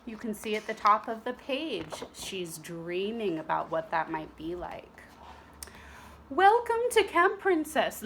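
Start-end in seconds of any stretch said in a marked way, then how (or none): 6.3-8.0 high, louder prim headmistress voice; a grand welcome to the ladies; prim teacher. Acted. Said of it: "said Madame Gertrude".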